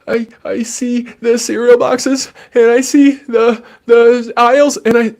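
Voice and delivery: put-on high, small child voice; panicked child. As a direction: play a nervous little kid. Child answering the parent.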